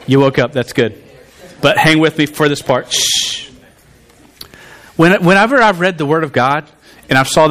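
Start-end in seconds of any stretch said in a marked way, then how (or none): 2.9-3.5 soft drawn-out shush; quiet the room with a shush; hushing shh. Real, not acted. Preacher settling a laughing congregation, soft shush.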